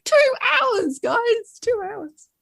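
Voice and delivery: high, loud pleading; mock exasperated plea. Writer protesting to readers that she had only two hours, high and pleading. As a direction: plead with them in comic exasperation. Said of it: "I'm like".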